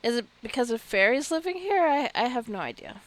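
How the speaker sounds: ordinary talk, nothing marked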